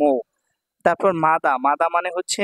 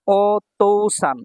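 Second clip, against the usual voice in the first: ওতৌসান stretched very slowly; slow model word; 2 semitones higher, much slower than usual. Shown as a demonstration. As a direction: say ওতৌসান slowly so they hear each part. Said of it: "teacher models word slowly".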